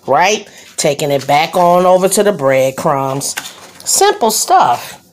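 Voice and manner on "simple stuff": high, slow, drawn-out sing-song; a playful high sing-song on simple stuff. Acted.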